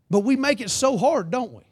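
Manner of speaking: normal talking voice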